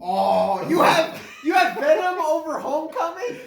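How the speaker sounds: can't believe the friend's ranking, shouting and laughing; incredulous outburst. Real, not acted. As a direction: blurt it out laughing in shock.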